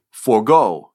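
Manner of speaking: "forgo" drawn out, weight on "go"; iamb stress. Shown as a demonstration. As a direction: slowly land on the second syllable of "forgo". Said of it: "is an iamb".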